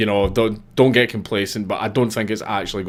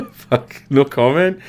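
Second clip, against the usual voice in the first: laughing it off; 8 semitones higher, slower than usual. Real, not acted. Laughs off a chat comment.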